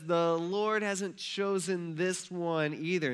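very slow, stretched-out delivery; drawn-out rejection. Acted. Samuel turning down yet another son, slow and reluctant. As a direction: drag out each word awkwardly.

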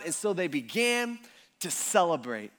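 ordinary talk

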